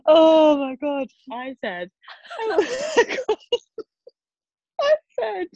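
high helpless laughter through words; can't get it out for laughing. Real, not acted. Trying to retell the call, laughing hard. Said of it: "can't even say it".

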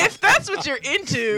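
high, loud, drawn-out reaction; loud exclamation. Real, not acted. Co-host reacting to talk of a bearded woman, loud exclamation. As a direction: call it out high and slow over the laughter.

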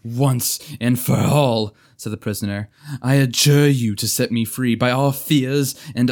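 loud, commanding archaic oath; solemn adjuration. Acted. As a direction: charge them solemnly.

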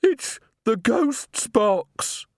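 high, very slow; fearful blurt. A narrator's reading. Missus Plinge blurts out the secret, high and slow. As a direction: let it slip in dread.

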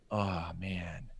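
disappointed whispered sigh; ski-school boss hearing his best instructor is leaving, whispered letdown; bummed out. Retold or imitated. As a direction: breathe it out, let down. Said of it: "he kinda was all bummed out".

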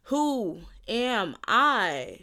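"Who am I" drawn out very slowly, word by word; emphatic. Real, not acted. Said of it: "like, just emphasize".

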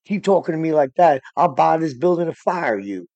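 boastful pastor. Retold or imitated. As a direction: puff yourself up and threaten to buy the place. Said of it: "and he's like".

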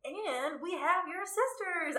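slow, high mock-villain announcement; lightly played villain's big reveal; gloating villain king. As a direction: draw it out like a game-show reveal. Acting the gloating King's announcement.

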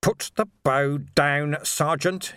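slow strained command from the ground; muffled, strained. Acted. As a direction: force it out, face down. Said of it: "he managed".

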